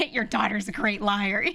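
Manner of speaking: slow, loud mock sarcasm with laughter near; imitating an angry father; mad dad. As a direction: drag it out, fed up. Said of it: "He was getting so mad".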